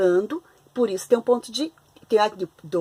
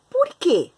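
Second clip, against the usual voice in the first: rising question tune on "por quê?"; question intonation; 9 semitones higher, 5 dB louder than usual. Shown as a demonstration. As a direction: ask "por quê?" with a clear high rise. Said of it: "você vai fazer uma entonação de pergunta". (entonação de pergunta em "por quê?")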